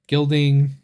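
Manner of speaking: ordinary talk, nothing marked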